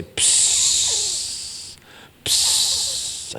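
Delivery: drawn-out hissed psst of approval; long admiring psst. Acted. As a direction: let out a long impressed hiss. God acted, impressed by the woodwork.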